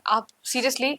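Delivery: ordinary talk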